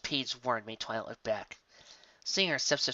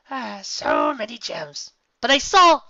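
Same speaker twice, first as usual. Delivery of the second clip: greedy dog; 10 semitones higher, 11 dB louder, slower than usual. A narrator's reading. Biscuit tempted by gems.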